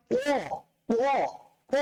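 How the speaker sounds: "প" said thick and full; thick, full-mouthed "প"; heavy letter sound. Shown as a demonstration. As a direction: pronounce "প" as a heavy, rounded letter. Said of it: "মোটা করে উচ্চারণ করতে হবে".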